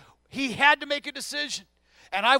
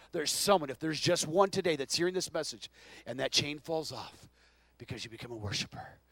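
hushed; 8 semitones lower, 6 dB quieter than usual. Real, not acted. Preacher drops to intimate appeal.

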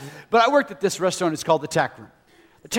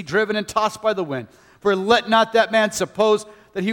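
preaching scripture; 3 semitones higher, 4 dB louder than usual. Acted. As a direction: proclaim the verses like a preacher. Reads scripture with force.

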